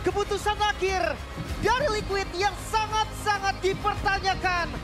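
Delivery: fighting game announcer. Real, not acted. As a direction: roar the result over the crowd. Caster proclaiming clean sweep.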